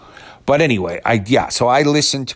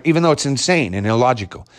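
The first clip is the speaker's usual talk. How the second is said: usual speaking voice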